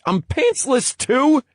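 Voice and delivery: high, loud shocked cry; shocked disbelief. Acted. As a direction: yelp it in disbelief. Drake discovers he is pantsless.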